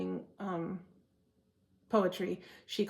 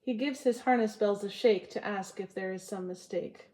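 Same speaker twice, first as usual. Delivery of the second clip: poem reading. Acted. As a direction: savour each line of the poem. Reads Frost poem to viewers.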